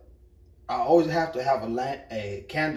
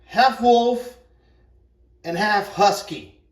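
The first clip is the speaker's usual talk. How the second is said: much higher and louder "half wolf"; amazed emphasis; 7 semitones higher, 7 dB louder, slower than usual. Real, not acted. Telling fellas about the wolf dog, excited. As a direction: stress "half" high and loud. Repeating the dog's breed.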